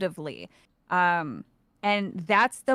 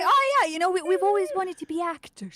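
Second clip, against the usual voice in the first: higher, laughing mock British accent; 7 semitones higher than usual. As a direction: play the hired actors in a put-on British accent, amused. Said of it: "choosing a British accent for them".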